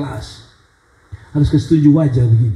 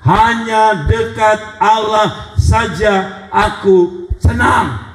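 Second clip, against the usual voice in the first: slow psalm reading; 4 semitones higher, much slower than usual. Acted. Quoting David's psalm.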